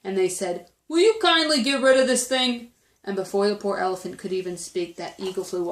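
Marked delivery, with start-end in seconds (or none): 0.9-2.7 higher, louder mock-polite request; loud, raised-pitch monkey demand; bossy monkeys. A narrator's reading. Monkeys hand clover to the eagle.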